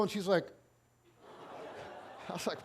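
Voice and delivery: ordinary talk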